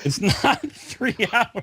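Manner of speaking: high, slow words broken by laughter; laughing protest. Real, not acted. Host correcting co-host's fake intro, laughing protest. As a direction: object through giggles.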